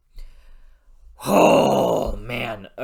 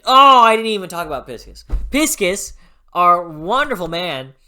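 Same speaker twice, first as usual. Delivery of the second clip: excited remembering; 5 semitones higher, 11 dB louder than usual. Real, not acted. Suddenly remembers favorite.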